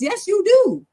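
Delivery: high, insistent comeback; emphatic rebuttal. Acted. Teacher answering a learner who skips practice, emphatic rebuttal. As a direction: jump up in pitch to contradict firmly.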